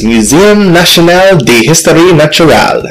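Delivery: "Muséum National d'Histoire Naturelle" said with a clumsy mock French accent; bad French accent. Shown as a demonstration. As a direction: try the French museum name in a poor French accent. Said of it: "that was a terrible accent".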